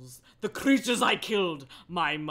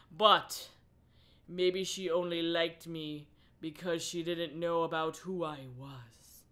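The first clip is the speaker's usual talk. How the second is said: ordinary delivery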